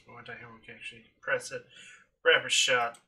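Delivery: ordinary talk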